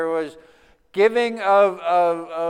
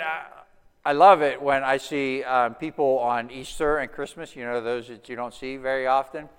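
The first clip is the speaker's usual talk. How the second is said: usual speaking voice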